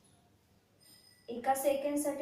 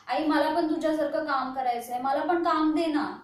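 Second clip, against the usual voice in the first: lightly voiced child's plea, louder; pleading little child; 3 semitones higher, 7 dB louder than usual. Daughter acting out her childhood self begging mother for work, lightly childlike pleading. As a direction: pester mother like an eager small child, loud. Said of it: "उगाच तिच्या कामामध्ये लुडबुड".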